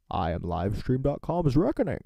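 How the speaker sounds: loud, booming masked villain; a big Bane impression. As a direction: boom it out like Bane. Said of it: "That's my Bane".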